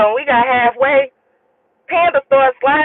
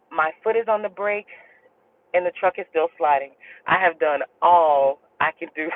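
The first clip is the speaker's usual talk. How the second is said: low, quiet retold calm; unfazed; 7 semitones lower, 6 dB quieter than usual. Driver telling passenger he can do no more, calm. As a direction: deliver it softly and evenly, imitating total composure. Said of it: "he never freaked out".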